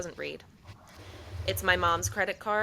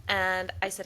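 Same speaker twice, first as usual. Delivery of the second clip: usual speaking voice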